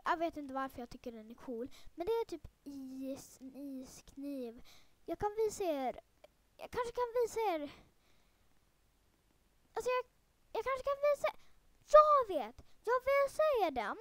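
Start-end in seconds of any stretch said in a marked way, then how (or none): 5.1-14.0 building excitement. Real, not acted. Teasing secret knife reveal.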